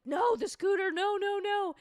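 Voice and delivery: high, loud panicked cries of no; mock screaming at the screen. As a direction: yell no in alarm at the scooter. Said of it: "a clip of myself going like".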